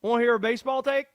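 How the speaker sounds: high, loud conspiracy rant voice; Conspiracy Josh voice. Acted. Character ranting a baseball hot take to Denton, high and loud.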